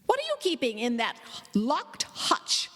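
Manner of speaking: breathy, suspicious question; a sharp whispered demand; aunt probing about the hutch. A narrator's reading. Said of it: "she asked".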